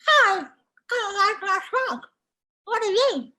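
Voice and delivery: high, loud, stretched-mouth frog voice; wide-mouthed frog. Acted. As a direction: hail them as an eager wide-mouthed frog. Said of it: "And he goes".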